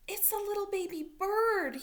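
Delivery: loud, high alarmed realization; surprised. Acted. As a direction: gasp it out in surprise. Frog spots drowning bird.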